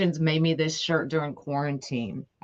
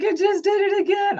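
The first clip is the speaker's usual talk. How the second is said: high, excited daughter voice, much higher than usual; teen daughter upset that the light flashed again; mimicking daughter; 3 dB louder than usual. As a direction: squeak it out like a spooked kid. Daughter's panicked quote retold.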